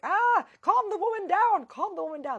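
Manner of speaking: very high, loud group-panic cry; mock frantic yell. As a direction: shriek it as alarmed friends. Friends reacting to her freakout.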